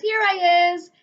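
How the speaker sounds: high, loud sing-out call. A narrator's reading. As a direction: call out to mum from the bath. Invisible child calls out to mother.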